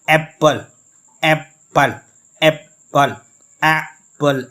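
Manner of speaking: Apple in two beats, the first one strong; stress on first syllable. Shown as a demonstration. Teacher showing students a stressed syllable, strong first syllable in Apple. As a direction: punch the ए of Apple loudly, drop the second syllable. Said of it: "ए को हम stress देकर पढ़ रहे हैं".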